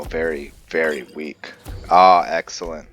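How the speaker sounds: ordinary talk, nothing marked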